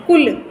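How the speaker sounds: कुल said quickly with a short u; snappy कुल, vowel not held. Shown as a demonstration. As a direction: correct कुल with a brief u. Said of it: "ऐसे नहीं बोलना है".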